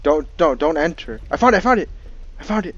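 high, loud panicked yelling; being chased. Real, not acted. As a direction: yell it while fleeing in panic. Being chased, finds item.

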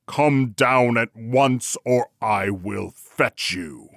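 slow, loud villainous husband; bawled order. A narrator's reading. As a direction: bawl it out slowly at the wife. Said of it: "bawled out loud as he could to his wife".

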